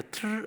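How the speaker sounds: high rolled trrr like a drum fill; drum roll imitation. Preacher imitating the worship drummer, rolled trrr.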